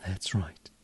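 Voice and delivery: soft whispered ooh and affirmation. Acted. Hypnotist soothing the listener, whispered. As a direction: sigh it out in a whisper.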